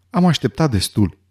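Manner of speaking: ordinary delivery, nothing marked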